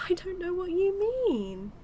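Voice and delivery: high, loud, sing-song innocence; exaggerated who-me protest; mock innocence. Villain feigns ignorance of hiding hostages.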